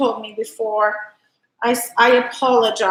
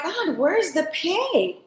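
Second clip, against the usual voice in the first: softer, slower puzzled mutter; lost, searching the phone, slightly higher; confused self; 5 semitones higher, 5 dB quieter, slower than usual. Retold or imitated. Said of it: "And I'm like".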